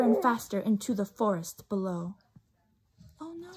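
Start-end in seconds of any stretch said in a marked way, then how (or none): 3.2-3.5 high, hushed oh no; mock alarm. A narrator's reading. Reader reacting to the falling bat, high and hushed. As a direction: gasp it out in mock worry.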